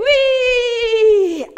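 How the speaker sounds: loud high cheering yes; joyful shout. Real, not acted. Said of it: "J'ai pas entendu".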